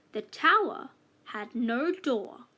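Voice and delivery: usual speaking voice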